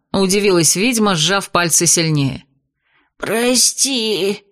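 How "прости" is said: задыхаясь. Acted. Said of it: "задыхаясь, выдавил из себя".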